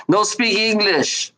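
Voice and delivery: hammed-up broken English; mock broken English. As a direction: pretend you can't speak English, slowly.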